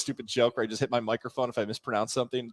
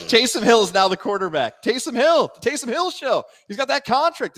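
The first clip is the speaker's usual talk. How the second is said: higher, louder announcer hype; mock hype; 9 semitones higher, 5 dB louder than usual. Co-host hyping a quarterback change, high mock hype. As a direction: sell it like big news.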